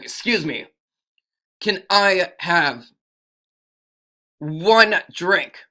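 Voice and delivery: firm, deliberate customer's request; stronger voice. Acted. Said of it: "maybe a little bit stronger voice".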